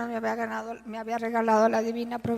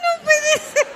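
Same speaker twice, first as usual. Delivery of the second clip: laughing disbelief; 12 dB louder, slower than usual. Real, not acted.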